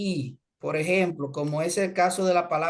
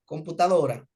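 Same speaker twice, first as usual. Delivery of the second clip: ordinary talk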